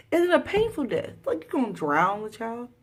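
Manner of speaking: high, loud, incredulous outburst; real horrified disbelief; appalled disbelief. Real, not acted. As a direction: voice shoots up, aghast. Appalled at drowning of child.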